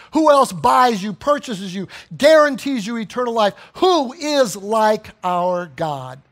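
loud, hammered rhetorical questions; impassioned preaching. Real, not acted. Preacher challenging congregation on who rivals God, impassioned. Preaching climax at full force.

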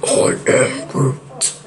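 very low, slow creature voice; Groot voice. Acted. Grown-up Groot answers his friend, deep creature voice. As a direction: sink deep and drag each word out. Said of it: "even your voice has changed".

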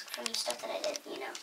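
breathy whispered aside; whispering. Real, not acted. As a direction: drop to a whisper. Whispered aside while regretting.

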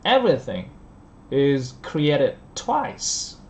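created and twice stretched very slowly; slow model stress. Shown as a demonstration. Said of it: "mình sẽ phát âm là". (đọc rất chậm, nhấn vào created)

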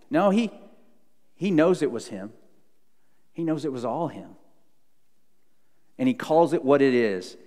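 usual speaking voice

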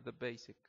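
"the basic" with "the" said fast and reduced; quick weak the. Shown as a demonstration. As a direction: run "the" into "basic". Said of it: "Rápido". ("the basic" con "the" rápido)

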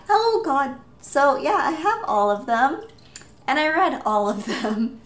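flustered laughing. Real, not acted. Books toppled, flustered laughter.

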